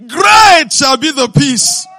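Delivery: booming proclamation. Acted. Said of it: "preached phrase repeated".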